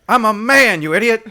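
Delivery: loud, high indignant shout; shouted movie line. Acted. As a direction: bellow it like an offended actress.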